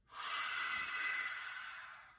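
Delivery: breathy lion exhale, tongue out; lion's breath exhale. Shown as a demonstration. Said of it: "Let's do it again".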